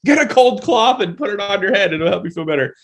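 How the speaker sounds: a hint of a caring grandma telling you what to do; a touch of a doting mother fussing over a sick child; mom and grandma voice. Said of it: "the mom and grandma thing is".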